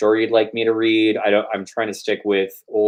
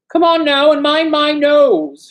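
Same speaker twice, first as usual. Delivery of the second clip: loud high shout; angry customer shouting at his barber; 6 dB louder, slower than usual. Acted. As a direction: bellow it at the barber, high and slow. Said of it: "shouted Kovalyov".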